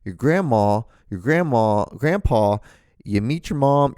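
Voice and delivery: normal talking voice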